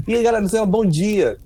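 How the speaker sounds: cheery carioca good-morning, re-enacted; animated greeting. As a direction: greet everyone brightly, pitched well up. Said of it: "sabe quando você vem todo animado?".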